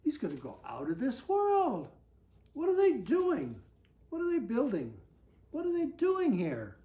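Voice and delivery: usual speaking voice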